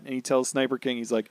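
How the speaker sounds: ordinary delivery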